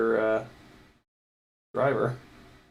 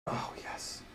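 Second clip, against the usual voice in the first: hushed whisper of relief; 16 dB quieter than usual. Real, not acted. Part slides in.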